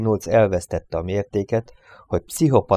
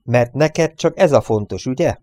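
louder, higher accusing shout; angry roar; 6 semitones higher, 6 dB louder than usual. A narrator's reading. Marcus accuses Reynolds and bangs the table, shouting. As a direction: shout the accusation at him, voice raised. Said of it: "ordította Marcus".

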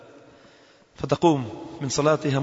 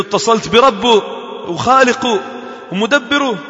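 fervent declaration; 7 semitones higher, 4 dB louder than usual. Retold or imitated. Proud declaration she called God.

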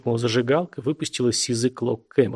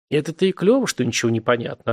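ordinary delivery, nothing marked

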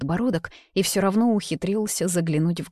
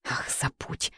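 breathy whispered give-up, much softer; hushed so the library won't hear; exasperated whisper; 3 semitones lower, 9 dB quieter, slower than usual. A narrator's reading. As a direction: throw it away in a whisper. Angry outburst cut off, whispered.

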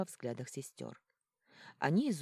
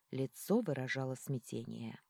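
ordinary delivery

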